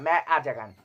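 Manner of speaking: ম্যা আজা গান said loud and flat, jeering; mocking the wrong name. Vlogger mocking reviewers who mispronounce ম্যা আজা গান, loud jeer. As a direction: parrot the botched title ম্যা আজা গান. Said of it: "আর কিচ্ছু বলতে পারে না".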